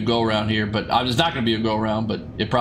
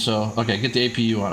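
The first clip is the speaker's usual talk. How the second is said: ordinary delivery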